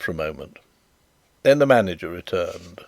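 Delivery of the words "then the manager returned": ordinary delivery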